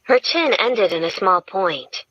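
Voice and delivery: ordinary delivery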